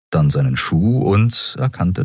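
usual speaking voice

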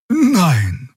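just the plain truth, lightly; truthful Xia. A narrator's reading. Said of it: "antwortete sie wahrheitsgemäß".

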